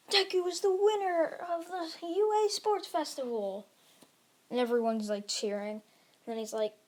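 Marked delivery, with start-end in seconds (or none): 0.1-3.7 high-pitched sports festival announcer; President Mike. Acted. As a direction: proclaim the winner in a drawn, raised announcer voice. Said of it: "And President Mike's like".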